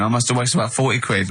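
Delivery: ordinary talk, nothing marked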